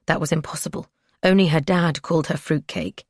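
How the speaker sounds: plain reading voice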